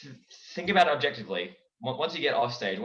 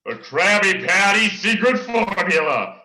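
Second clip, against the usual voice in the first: louder, slow and scheming; cartoon villain voice; 6 semitones higher, 9 dB louder, slower than usual. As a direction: gloat over the secret formula. SpongeBob impressions swap.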